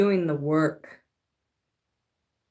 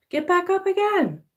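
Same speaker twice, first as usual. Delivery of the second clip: high sing-song encouragement; 11 semitones higher than usual. Shown as a demonstration.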